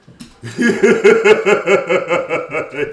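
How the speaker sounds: big burst of real laughter; a loud high laugh at her own joke. Real, not acted. Talks about freeloading son.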